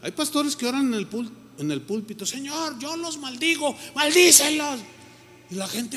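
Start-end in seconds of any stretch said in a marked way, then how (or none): 2.3-4.8 high, mock preacher calling down a curse; aggressive pulpit curse. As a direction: ham up an angry pulpit prayer. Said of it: "bien agresiva".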